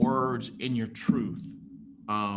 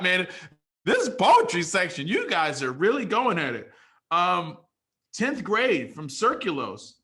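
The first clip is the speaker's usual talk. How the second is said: higher, louder MC voice bringing up the next poet; hype announcer; 7 semitones higher, 5 dB louder than usual. Acted. Host introducing Nora to the crowd, loud hype call. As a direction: pump up the room like an emcee.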